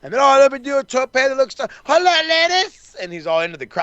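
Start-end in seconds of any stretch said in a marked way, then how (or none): 0.2-3.0 high-pitched preening ladies' man; showboating heartthrob impression in a high voice; Latin heartthrob. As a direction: play a mock Latin lover, pitched way up. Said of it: "he's the Latin heartthrob".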